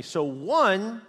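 ordinary talk, nothing marked

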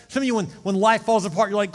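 loud, high, driving preacher voice; fervent preaching. Acted. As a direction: preach it out to the whole church. Preaching at full force.

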